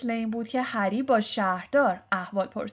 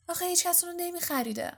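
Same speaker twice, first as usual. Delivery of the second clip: high, quick, pitying lilt; 9 semitones higher, 4 dB quieter, faster than usual. A narrator's reading. Girl pitying a cat nobody would buy, high and tender. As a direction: raise the voice in soft-hearted pity. Hermione pities the unsold cat.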